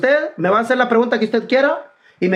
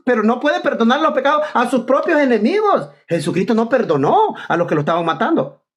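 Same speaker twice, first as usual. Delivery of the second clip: ordinary delivery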